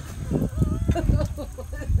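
held-back giggle; stifled giggles. Real, not acted. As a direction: giggle while trying to hold it in. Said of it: "I'm trying not to laugh".

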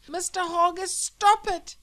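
high, loud desperate cry through the wall; frightened cry. Acted. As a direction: beg them to stop at the top of his voice. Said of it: "he cried".

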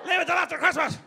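high, loud squeal of dismissal; exasperated shout. Acted. Worker putting off work till Christmas, high shout. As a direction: yell it high to shut it down.

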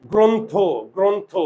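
গ্রন্থ in two clear beats, "gron-tho"; jaw practice. Shown as a demonstration. Teacher practising with the jaw, গ্রন্থ said as "gron-tho". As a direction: sound out গ্রন্থ with both vowels a rounded "o". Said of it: "মুখের চোয়াল দ্বারাও practice করি".

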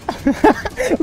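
short high, soft giggling fit; real helpless giggle; high laughter. Real, not acted. Laughing at crying child.

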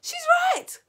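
very high, loud agreement; calling out. A narrator's reading. Another witch backing up Mildred, calling out. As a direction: call it across the room, high. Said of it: "called another voice".

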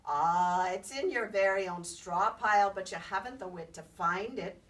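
loud, gruff grumble; a sulky fairy man griping; grumbling leprechaun. Acted. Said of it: "grumbled the leprechaun".

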